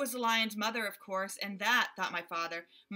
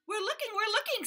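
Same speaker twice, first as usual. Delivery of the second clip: much higher, chattering monkey voices; little monkeys; 12 semitones higher, 4 dB louder than usual. A narrator's reading. Monkeys reassuring the gorilla in high squeaky voices. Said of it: "said the six monkeys".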